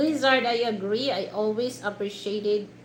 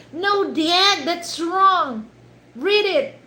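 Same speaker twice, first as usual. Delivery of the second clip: loud, higher-pitched retold correction; exasperated daughter; 8 semitones higher, 7 dB louder, slower than usual. Daughter correcting her dad's reading, loudly insistent. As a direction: imitate a daughter insisting dad is wrong. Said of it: "And then the daughter".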